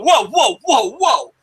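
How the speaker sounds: imitating his shocked objection; startled protest. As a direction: back off in mock alarm. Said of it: "And I was like".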